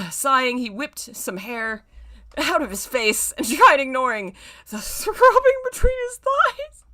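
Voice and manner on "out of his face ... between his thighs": rising into a high laughing squeak; squealing laugh while reading. Real, not acted. Reading the explicit line, voice squeaking with laughter. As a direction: let the last word crack into laughter.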